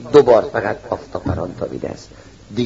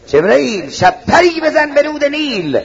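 high ringing command; 10 semitones higher than usual. Retold or imitated. God commands Gabriel to strike.

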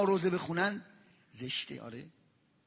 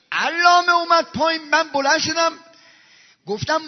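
ordinary delivery

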